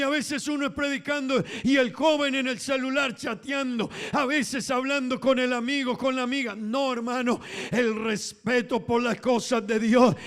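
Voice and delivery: high, driving sermon voice; full-force testimony to the congregation; fervent preaching. Real, not acted.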